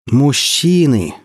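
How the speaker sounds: slow, drawn-out loaded "men!"; that certain tone. A narrator's reading. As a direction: stretch it out, all-knowing and weary. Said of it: "тем самым тоном".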